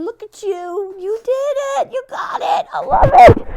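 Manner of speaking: high, loud, delighted cheering; big cheer. Acted. Volunteer cheering the camp mom's success, high and loud. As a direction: celebrate her win out loud.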